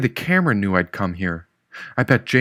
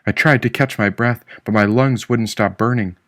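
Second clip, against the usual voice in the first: ordinary delivery, nothing marked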